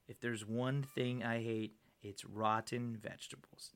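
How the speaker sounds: slow, low, quiet tough-guy delivery; deadpan, softly menacing movie hero; action-hero one-liner. Acted. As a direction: drop low and drag out the tagline. Repeats line as action-hero tagline.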